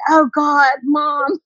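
high-pitched imitation of embarrassed kids; mock exasperated. Kids embarrassed by their mum, mock exasperation. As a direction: groan it as a mortified child, hammed-up. Retelling embarrassed kids' protest.

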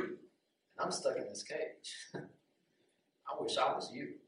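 soft, understated envy; lightly voiced wistful captive, hushed; wistful peacock. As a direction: confess a longing quietly. Caged peacock envies free crow.

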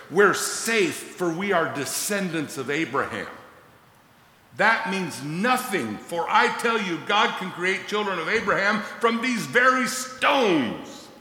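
high, loud preaching shout; rising to a full shout; fiery rebuke. Acted. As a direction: thunder the rebuke at the crowd. Preaching John the Baptist's rebuke.

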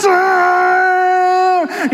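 yelled curse. Retold or imitated. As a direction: blurt it out in frustration. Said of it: "And then I yelled out".

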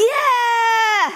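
high, squealing cheer; re-enacted elated shout. Celebrating the cat's return, hammed-up elated shout. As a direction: let out a hammed-up shriek of celebration.

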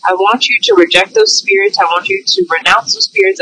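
ordinary talk

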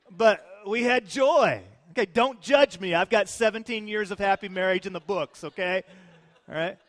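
loud, high emphatic preaching. Acted. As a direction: proclaim it with full fervor. Preaching at full force.

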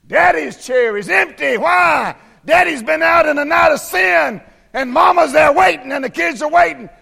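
high, loud revival preaching; preaching at full force. Acted. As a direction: bring it up and hammer the congregation. Preaching continues.

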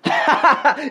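high-pitched burst of real laughter. Real, not acted. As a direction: crack up at your own impression. Laughs at own imitation.

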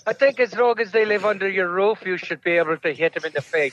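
thick stage-Irish brogue, words slurred together; mumbled comic Irish priest; garbled Irish accent. Acted. Father Flanagan answering the spanking question, garbled Irish accent. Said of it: "I couldn't understand a word he said".

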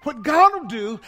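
ordinary delivery

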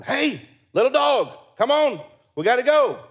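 calling the dog. Retold or imitated. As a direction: coax the motionless little dog.

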